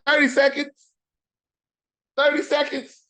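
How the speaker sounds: high, loud incredulous cry; stunned disbelief. Real, not acted. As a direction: shriek it in disbelief. Disbelief at a quick loss.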